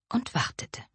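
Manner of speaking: breathy whisper, dropping away. A narrator's reading. Narrator pauses, waiting goblin.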